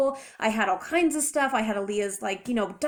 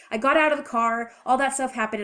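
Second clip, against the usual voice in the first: usual speaking voice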